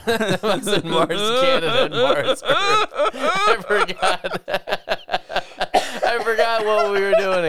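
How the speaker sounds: giggling helplessly through the words; laughing at himself. Real, not acted. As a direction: crack up while admitting the slip. Cracks up at own mistake.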